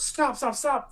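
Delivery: high urgent yell; yelling stop. Acted. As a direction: call out high to halt them. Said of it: "runs out and yells".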